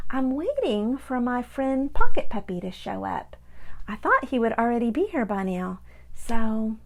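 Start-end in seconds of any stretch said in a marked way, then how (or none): none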